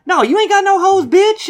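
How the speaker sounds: high, loud trash-talking taunt; mock heckling yell. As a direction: yell it like a heckling gamer kid. Friend heckles during game, acted out.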